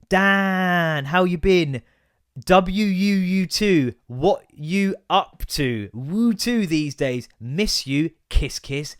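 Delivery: very slow, drawn-out read-aloud; loud, stretched mocking recital; mock message reading. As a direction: spell out every clumsy word laboriously.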